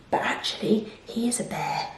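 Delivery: whispering the secret to the listener. Real, not acted. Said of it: "secret told to children".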